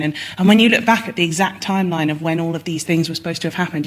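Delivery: ordinary talk, nothing marked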